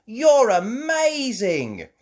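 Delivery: high, slow, loud awe; amazed. A narrator's reading. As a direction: gush with delight. Kids praise Mummy.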